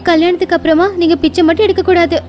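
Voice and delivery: put-on a firm young woman laying down one rule; young woman's voice. Young bride Kamala speaks. (உறுதியான இளம் பெண்ணின் குரல்)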